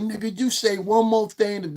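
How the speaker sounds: a hint of a woman threatening with a knife; lightly voiced angry woman. Dominican woman warning him with a knife, mild slow threat. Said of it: "That's exactly how she said it".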